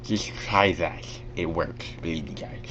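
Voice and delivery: soft, slightly lisped demo; side-of-mouth lisp. Shown as a demonstration. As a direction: talk out of the side of the mouth, softly. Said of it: "out of the side of your mouth".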